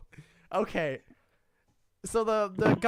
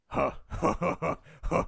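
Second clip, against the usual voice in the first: mocking orc chuckle. Orc shaman mockingly chuckles at the prisoner, amused. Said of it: "watches you do this and goes".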